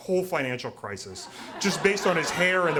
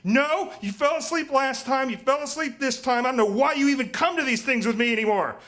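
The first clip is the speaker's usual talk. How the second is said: much higher, louder nagging wife; 10 semitones higher, 4 dB louder than usual. Retold or imitated. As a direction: squeal it like an exasperated girlfriend scolding. Couple bickering in cancer ward.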